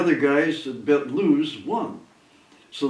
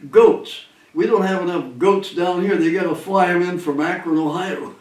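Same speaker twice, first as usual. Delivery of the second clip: booming yelled greeting, higher and louder at first; retold yell; 3 semitones higher, 3 dB louder than usual. Jocko greeting the pilots coming in the door, retold yell. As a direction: imitate a man hollering across the room. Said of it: "he'd yell out".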